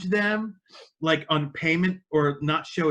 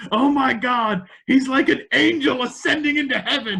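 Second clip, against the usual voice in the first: higher, louder, over-the-top amazement; mock awestruck commentator; 8 semitones higher, 5 dB louder than usual. Commentator watching a huge wrestler crawl into the ring, mock awe. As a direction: marvel like it's a miracle. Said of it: "Byron Jay starts going".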